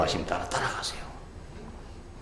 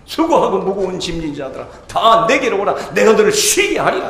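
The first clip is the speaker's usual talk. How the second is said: preaching scripture; 8 semitones higher, 8 dB louder than usual. Acted. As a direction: proclaim it to the congregation. Preacher quotes scripture loudly.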